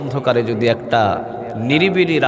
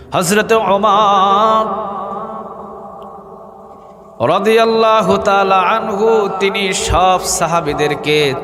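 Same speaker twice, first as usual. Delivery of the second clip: high, drawn-out chanting delivery; melodic preaching; 8 semitones higher, 4 dB louder, much slower than usual. A narrator's reading. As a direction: intone the holy name, stretching it long. (সুর করে টেনে টেনে ওয়াজ)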